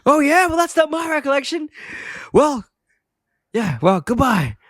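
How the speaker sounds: high-pitched indignant phone replies; mock-huffy comebacks to an unheard caller, winding down to a sign-off; hammed-up angry voice giving up the argument; irate Homer. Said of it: "hear how irate Homer is".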